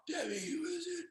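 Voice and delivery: imitating a sick father's airless, strained question; breathy, barely voiced call to his wife; dying father's strained voice. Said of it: "he's saying to my mom".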